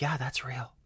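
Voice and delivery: soft, whispered gullible voice; mock whisper. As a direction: whisper it like a believer. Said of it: "people are like".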